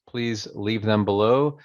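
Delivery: ordinary talk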